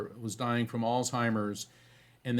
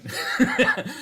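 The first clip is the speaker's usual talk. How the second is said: airy burst of high laughter; breathy high laugh; 11 semitones higher, 5 dB louder than usual. Real, not acted. Enjoying the irony of winning the argument, laughing.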